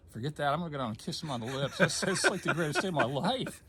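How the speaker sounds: fast, laughing and elated; giddy with relief, laughing through it; laughing joy. Real, not acted. Telling the doctor not to worry, laughing with joy.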